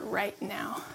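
a soft whispered close to the prayer. Real, not acted. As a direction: let it fall to a whisper. Prayer for comfort of the lonely.

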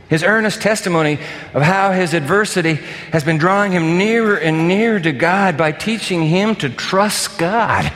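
usual speaking voice

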